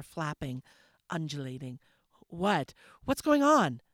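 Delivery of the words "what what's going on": alarmed question. Acted. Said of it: "I inquired".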